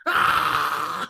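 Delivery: loud, low barbaric shout; mock Klingon yell. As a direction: bellow it like a Klingon warrior. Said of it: "Worf just".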